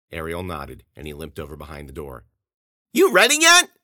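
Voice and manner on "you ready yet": much higher and louder impatient yell. Acted. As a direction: bellow it from the living room. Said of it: "Grossman yelled".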